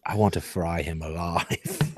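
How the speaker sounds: put-on a thick New Zealand accent, low and soft. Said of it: "in kind of a thick New Zealand accent".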